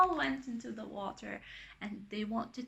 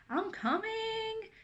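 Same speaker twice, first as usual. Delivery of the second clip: high, drawn-out call; stretched sing-out to someone far away; 11 semitones higher, slower than usual. A narrator's reading. Anna's father answering her laughter, calling out. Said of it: "shouts Anna's father".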